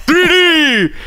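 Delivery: high, squeaky cartoon shout; silly puppet voice. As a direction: yell it like a goofy puppet. Swedish Chef style bit.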